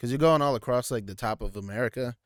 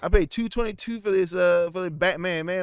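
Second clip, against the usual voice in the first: loud, high hammed-up complaint; hammed-up angry ticket buyer yelling; mock angry moviegoer; 7 semitones higher, 6 dB louder than usual. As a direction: play an outraged moviegoer who paid.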